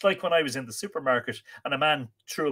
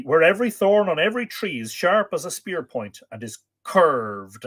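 emphatic, swelling storytelling delivery; dramatic story narration; 4 semitones higher, 6 dB louder than usual. A narrator's reading. Storyteller warning of a perilous journey, dramatic narration. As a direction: lean on the dark words, drawing some out.

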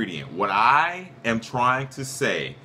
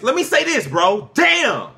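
real shout, pitched way up; angry outburst; 8 semitones higher, 6 dB louder, slower than usual. Real, not acted. Co-host fed up with being cut off, angry shout. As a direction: yell to get the floor, exasperated. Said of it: "You're angry".

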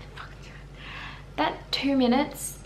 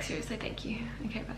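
sleepy whisper, voice dropping away; nodding off; 11 dB quieter, faster than usual. Real, not acted. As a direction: whisper as if dozing off. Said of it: "'cause I'm falling asleep".

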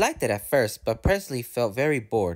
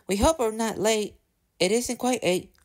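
high-pitched, fretful coach voices; anxious twittering; 10 semitones higher than usual. A narrator's reading. Annie and Clara arriving late, anxious twitter. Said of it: "Annie and Clara twittered anxiously".

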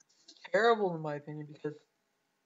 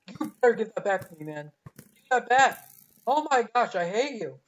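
loud, heated shout at a teammate; angry yelling; 3 semitones higher, 8 dB louder than usual. Real, not acted. Yelling at the player who threw his sword, angry. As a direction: demand the sword back, furious.

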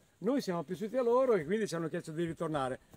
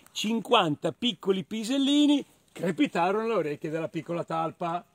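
ordinary delivery

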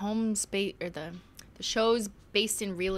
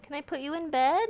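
put-on southern accent; 9 semitones higher, 6 dB louder than usual. Talking to her pet Minty, in a high Southern accent. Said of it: "Your southern accent is perfect".

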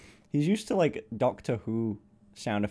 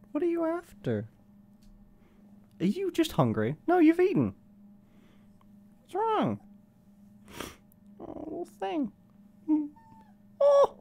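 put-on high, sing-song baby talk; baby talk to a puppy. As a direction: coo at the little dog like a baby. Asking the puppy what he wants.

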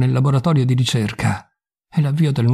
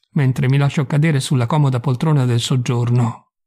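ordinary delivery, nothing marked

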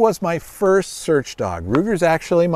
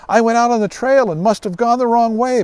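put-on higher, louder voice of a lost girl; little girl Betty; 8 semitones higher, 5 dB louder than usual. Lost girl Betty telling rescuers, lightly childlike. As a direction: sound like a small girl relieved to be found. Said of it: "Betty said".

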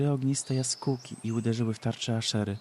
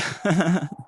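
high, loud crazed cackle; short crazed laugh; mad cackle; 6 semitones higher, 6 dB louder than usual. Acted. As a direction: cackle like a madwoman.

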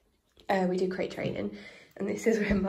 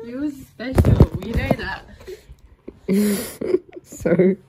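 laughing; 3 semitones higher, 6 dB louder, slower than usual. Real, not acted. Teasing baby.

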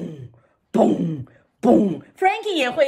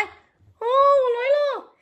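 puppet voice; 11 semitones higher than usual. Acted. Puppet Frankie arrives.

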